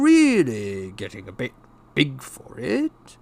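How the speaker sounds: slow, painfully strained niceness; forced smile. A narrator's reading. Uncle offering nephew a bedroom, slow forced pleasantness. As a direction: feign friendliness, dragging it out in swoops. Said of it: "forced his face into a smile".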